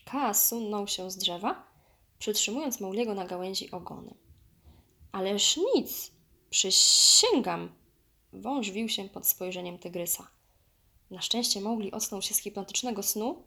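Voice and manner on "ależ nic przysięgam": slow, loud, stretched sibilants; hissing snake. A narrator's reading. Python denying all to the tiger, hissing. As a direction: draw out the hiss in a squirming denial.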